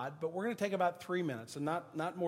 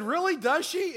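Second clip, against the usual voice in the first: high, loud mock astonishment; feigned surprise; 11 semitones higher, 9 dB louder than usual. Pastor answers bagger about his own mother, exaggerated surprise. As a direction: act amazed, pitched way up.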